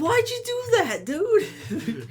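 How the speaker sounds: very high, loud, incredulous squeal; mock disbelief. Retelling his shock at a friend's extra tabs, squealing high. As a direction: act shocked at your friend's choice.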